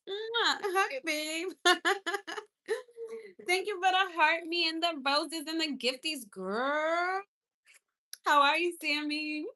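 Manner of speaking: high, loud, gushing thanks; excited gushing. Real, not acted. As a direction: squeal your thanks. Thanking viewer for gifts.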